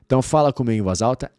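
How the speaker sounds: ordinary delivery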